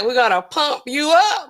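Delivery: lightly voiced gym-muscle pump-up line; playful mock bodybuilder cheer; Arnold Schwarzenegger. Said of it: "what Arnold Schwarzenegger used to be like".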